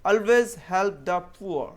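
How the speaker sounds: very slow and loud, each word apart; slow model sentence. Shown as a demonstration. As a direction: read it out loud and slowly for the class. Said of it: "कुछ sentence देख लेते हैं". (ज़ोर से धीरे धीरे वाक्य)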